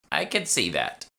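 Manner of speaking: put-on high, dry old woman's remark; a hint of a knowing shopkeeper.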